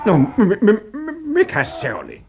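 loud, high, stuttering alarm; alarmed, voice shooting up; startled stammer. Acted. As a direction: jump and stammer in fright. Startled by a sudden scream outside.

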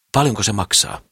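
plain reading voice

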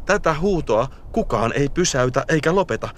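rally like a chorus refusing silence; rising, forceful narration, slowing at the end; defiant build. A narrator's reading.

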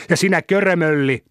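put-on high-pitched childish insult; taunting comeback. A narrator's reading. Boy returns Vesa's insult, taunting. Acted insult thrown back.

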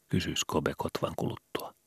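usual speaking voice

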